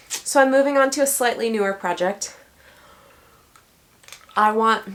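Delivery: talking around a mint in mouth; words a bit thick with a mint in mouth. Real, not acted. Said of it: "sorry, I have a mint in my mouth".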